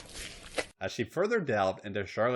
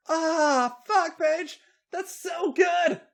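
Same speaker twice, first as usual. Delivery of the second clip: very high, loud shriek; acted high-pitched shrieking; 7 dB louder, much slower than usual. A narrator's reading. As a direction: shriek it with drawn-out last words. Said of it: "Becky shrieked".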